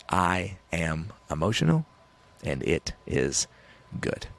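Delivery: slow affirmation, word by word; deliberate declaration. Acted. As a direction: spell out a conviction slowly, with pauses. Declaring his new belief.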